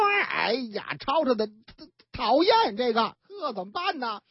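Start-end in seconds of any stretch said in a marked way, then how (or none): none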